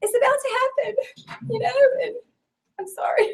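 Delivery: fighting back tears while talking; emotional, near tears; tearful. Real, not acted. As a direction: voice choking up, high and strained. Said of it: "I'm the crier".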